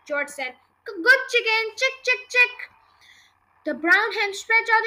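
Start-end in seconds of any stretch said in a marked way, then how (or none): 0.9-2.7 high, raised coaxing call; pitched-up animal-calling voice; coaxing a chicken. Acted. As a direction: coax the hen with little high calls. Boy coaxing a hen to eat.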